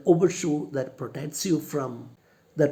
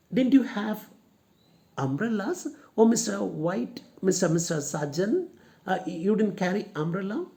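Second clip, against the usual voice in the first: higher, curious lady's question; naive lady; 6 semitones higher than usual. Shown as a demonstration. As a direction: ask as an innocent housewife.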